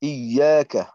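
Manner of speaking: ইয়াকা said slowly as "iyyaaka", doubled y; recitation demo. Shown as a demonstration. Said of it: "চতুর্থ আয়াতে".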